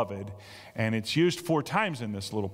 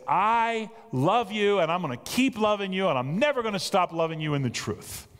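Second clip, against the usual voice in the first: emphatic declaration; 6 semitones higher than usual. Retold or imitated. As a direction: proclaim it, then let it settle. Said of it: "he is very emphatic".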